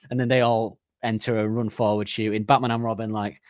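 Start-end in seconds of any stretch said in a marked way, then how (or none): none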